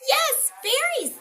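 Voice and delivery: high, cheeky piggy reply; little pig voice. A narrator's reading. Little pig teasing the wolf from the tree, high. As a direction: answer high and sly. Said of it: "said the little pig".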